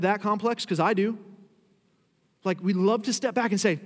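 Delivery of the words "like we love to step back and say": ordinary talk, nothing marked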